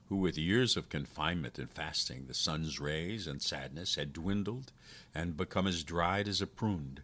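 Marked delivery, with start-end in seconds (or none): none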